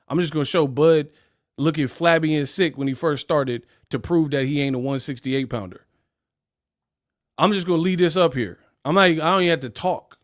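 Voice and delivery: ordinary talk, nothing marked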